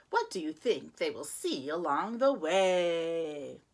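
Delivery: usual speaking voice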